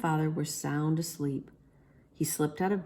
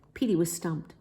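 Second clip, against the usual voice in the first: usual speaking voice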